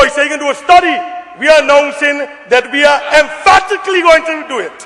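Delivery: normal talking voice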